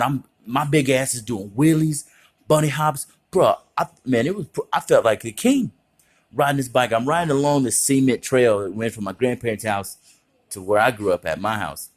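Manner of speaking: ordinary talk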